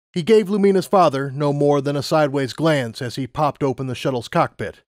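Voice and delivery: usual speaking voice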